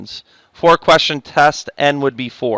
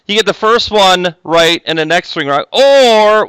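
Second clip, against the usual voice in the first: drawn-out, higher and louder, deliberately obnoxious; 7 semitones higher, 5 dB louder, slower than usual. Shown as a demonstration. Teacher stressing alternatives to the class, obnoxiously. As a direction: lean on it to annoy the class. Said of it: "why am I saying or obnoxiously".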